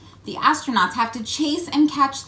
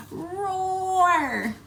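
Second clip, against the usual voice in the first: high rocket roar; 9 semitones higher than usual. Pretend. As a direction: shout "Roar!" high and playful. Rocket sound effect.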